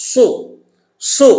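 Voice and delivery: "সু" thick with rounded lips; rounded Saad SU. Shown as a demonstration. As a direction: round the lips hard on heavy "সু". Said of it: "ঠোঁটটা গোল করবেন এখন". (ঠোঁট গোল করে মোটা "সু")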